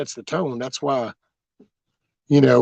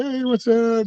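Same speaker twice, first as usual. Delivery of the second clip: friendly greeting; 11 semitones higher, slower than usual. Retold or imitated. Customers greeting the driver.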